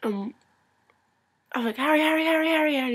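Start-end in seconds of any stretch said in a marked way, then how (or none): 1.8-2.9 high, loud, rapid-fire name calls; retold insistent calling, pitched up; urgent attention-getting. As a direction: pester someone by repeating their name. Calling to get Harry's attention.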